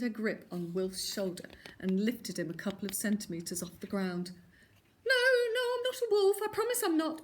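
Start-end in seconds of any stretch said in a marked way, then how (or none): 5.0-7.2 high, loud panicky denial; protesting. A narrator's reading. Dangling wolf denying he's a wolf, high panicky protest. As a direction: plead your innocence fast. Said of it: "protested Wilf".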